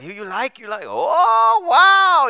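high, loud hammed-up "oh, wow"; over-the-top imitation of hosts exclaiming wow; mock amazed wow. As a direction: play the locals gushing wildly. Locals acting out amazement.

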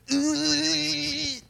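put-on toddler battle grunt. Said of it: "he goes".

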